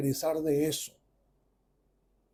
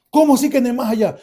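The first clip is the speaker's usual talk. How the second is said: high, loud startled question; incredulous; 6 semitones higher, 6 dB louder, faster than usual. Retold or imitated. Son hears father say he visited the beyond, incredulous. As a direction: react in disbelief. Son reacts to the afterlife claim.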